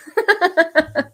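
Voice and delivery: a burst of high, loud laughter. Real, not acted. Streamer laughing loudly at own joke to chat.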